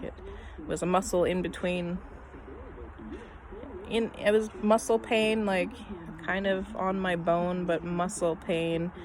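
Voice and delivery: ordinary talk, nothing marked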